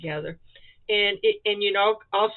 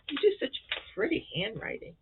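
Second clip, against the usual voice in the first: normal talking voice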